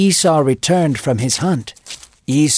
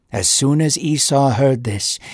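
usual speaking voice

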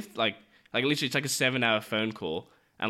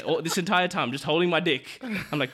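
ordinary talk, nothing marked

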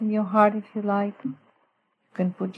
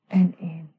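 whisper; 2 semitones lower than usual. Shown as a demonstration. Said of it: "let me just walk through a little prayer".

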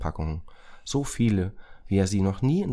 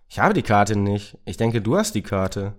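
Racket, defensive; 3 semitones higher, 7 dB louder than usual. Acted.

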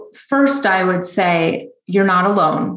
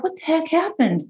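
bewildered; 8 semitones higher, 5 dB quieter than usual. Retold or imitated. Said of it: "we're like".

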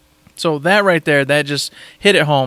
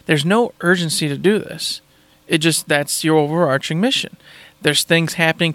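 usual speaking voice

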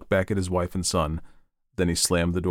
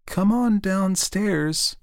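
high, slow, wavering voice; wavering call; 13 semitones higher, much slower than usual. A narrator's reading. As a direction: call upstairs uncertainly, drawing it out. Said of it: "called in a wavering voice".

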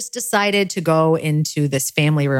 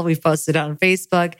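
normal talking voice

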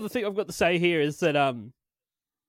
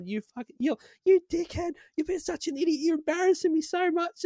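higher, angry daughter voice; mock yelling daughter; 11 semitones higher, 3 dB quieter than usual. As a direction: yell it like a furious teen. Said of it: "She's yelling at him".

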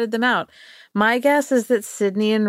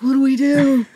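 mock panic; 7 semitones higher, 5 dB louder than usual. Panicking brothers quote.

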